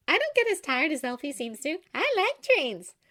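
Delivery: high, bright and chirpy; bright Glinda. A narrator's reading. Glinda answering Oscar brightly, high and chirpy.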